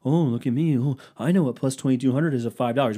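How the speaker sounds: hammed-up know-it-all voice; mock know-it-all; mock smug listener. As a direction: sneer it like a smug gambler.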